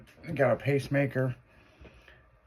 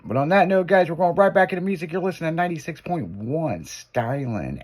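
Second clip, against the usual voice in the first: radio host outro; 4 semitones higher than usual. Acted. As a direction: throw to the music like a DJ. Radio host wraps to music.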